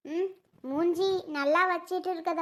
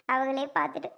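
ordinary talk, nothing marked

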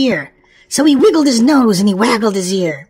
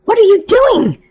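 higher, louder surprised ask; surprised question; 8 semitones higher, 3 dB louder than usual. Acted. Rabbit finds the crying men, surprised and louder. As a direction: ask it amazed. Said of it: "Peter said with surprise".

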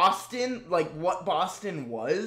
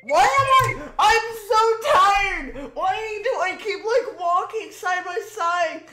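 high, loud, laughing squeal of embarrassment; squealing laughter; 13 semitones higher, 4 dB louder than usual. Real, not acted.

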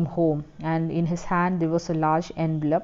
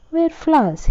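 much higher, louder eager offer; bright suggestion; 10 semitones higher, 6 dB louder than usual. A narrator's reading. Husband cheerfully advising his wife, eager and high. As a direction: pitch it up as a quick brainwave.

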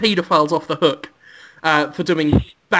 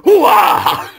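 Pacino impression; 3 semitones higher than usual. Pacino reassures the lawyer couple, shouting hoo-ah. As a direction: shout then wave off worries. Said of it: "Al Pacino's like".

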